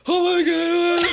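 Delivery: very high, drawn-out mock shock; mock surprise. Nun who just killed somebody, mock surprise. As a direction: stretch it out in hammed-up astonishment. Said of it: "it's a surprise".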